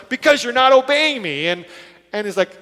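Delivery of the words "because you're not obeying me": loud, high-pitched indignant outburst; angry retort. Retold or imitated. Balaam answering his disobedient donkey, angry. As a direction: snap back loudly, acting out fury. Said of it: "he's so angry with this donkey".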